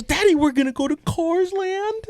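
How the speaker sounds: high-pitched, thrilled child impression; excited little daughter. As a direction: mimic a kid juiced about a trip. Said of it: "She's fucking all juiced about it".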